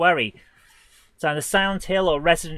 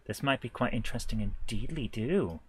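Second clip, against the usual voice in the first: lower, softer musing to himself; quietly amused mock-quaint remark; playful musing; 5 semitones lower, 6 dB quieter than usual. Real, not acted. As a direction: repeat it low and soft, with a silly lilt at the end. Repeats the remark in silly form.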